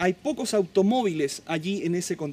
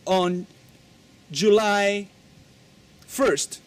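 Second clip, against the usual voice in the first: slow, louder spaced-out answer; slow model answer; 3 dB louder, much slower than usual. Shown as a demonstration. Teacher modeling the reply for students. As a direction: spell out each word slowly. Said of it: "And you say".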